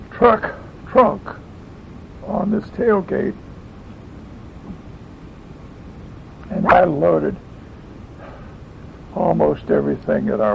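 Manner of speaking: hiccuping mid-sentence; hiccups. Real, not acted. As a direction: voice jumping up with hiccups. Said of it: "I got the hiccups".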